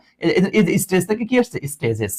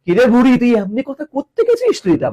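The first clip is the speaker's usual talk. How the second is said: higher-pitched mock questioning of a child; lightly put-on teasing voice, pitched up; imitating a teaser; 5 semitones higher than usual. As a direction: tease someone for talking like an old woman. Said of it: "বলেন অনেক সময়".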